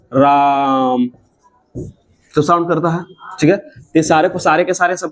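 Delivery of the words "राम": रा in राम held long, three-beat pluta vowel; drawn-out Ram. Shown as a demonstration. Teacher demonstrating a pluta vowel, drawn-out राम. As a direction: stretch the aa of राम out long and low. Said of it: "देखो ना, पढ़ा होगा".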